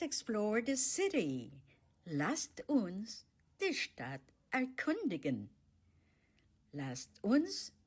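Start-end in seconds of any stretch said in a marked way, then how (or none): none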